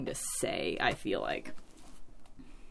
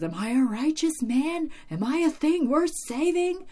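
higher-pitched mock anguished questioning; imitated desperate demand; 10 semitones higher than usual. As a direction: plead dramatically, as if shaking someone. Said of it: "shakes her and, like, says".

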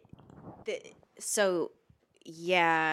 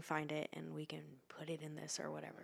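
whispered aside; 10 dB quieter, faster than usual. Real, not acted. Whispered aside while eating.

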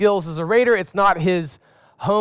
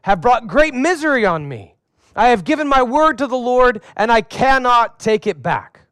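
mild lament; 5 semitones higher, 5 dB louder than usual. A narrator's reading. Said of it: "he does it again".

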